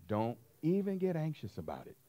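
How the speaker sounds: normal talking voice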